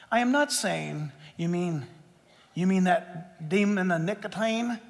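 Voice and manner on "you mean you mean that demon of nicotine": softer, lower questioning voice; doubting listener. Retold or imitated. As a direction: ask it like a puzzled skeptic, quietly.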